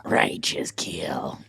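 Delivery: slow unvoiced whisper; whispered Quado voice. Shown as a demonstration. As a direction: breathe it out slowly and quietly. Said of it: "like Quado".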